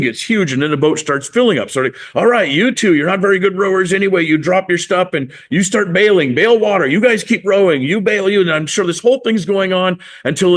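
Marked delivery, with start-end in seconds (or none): 2.1-8.4 much higher, urgent skipper; mock panic taking charge; acted frantic orders. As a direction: fire off bailing commands to a crew. Acted orders as boat fills up.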